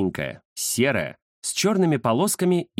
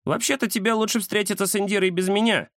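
lightly voiced high-pitched cat Кузя; Кузя's sudden announcement; 10 semitones higher than usual. A narrator's reading. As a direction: pipe up in a higher cat voice. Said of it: "неожиданно заявил Кузя". (внезапное заявление высоким голосом кота)

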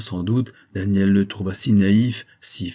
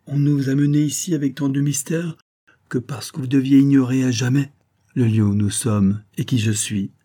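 plain reading voice

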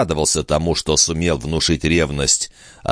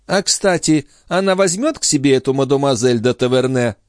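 higher-pitched lady's question; lightly voiced countess; 9 semitones higher than usual. A narrator's reading.

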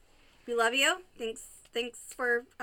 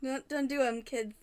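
ordinary talk

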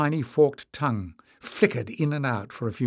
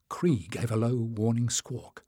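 ordinary delivery

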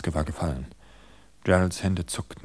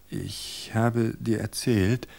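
slow, deliberate opening; slow start; 3 semitones higher, much slower than usual. Acted. Wife beginning her invented story slowly. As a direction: draw it out slowly. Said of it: "begann sie langsam".

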